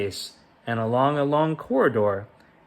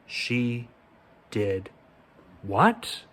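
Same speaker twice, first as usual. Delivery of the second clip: shocked king; much slower than usual. A narrator's reading. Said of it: "cried the king".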